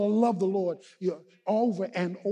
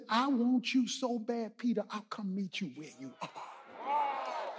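tender, hushed appeal; 8 dB quieter, slower than usual. Acted. Jesus offering to meet Peter where he is, tenderly. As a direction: drop down gently, as if pleading closely.

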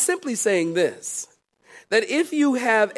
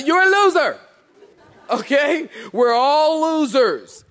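fervent preaching; 5 semitones higher than usual. Acted. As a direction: preach it with fire. Preaching at full force.

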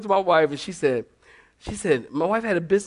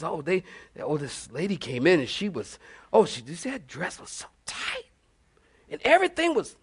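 scandalized gossip; 5 dB quieter than usual. Retold or imitated. Mimicking scandalized church gossip.

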